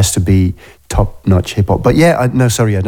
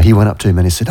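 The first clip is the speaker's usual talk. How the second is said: normal talking voice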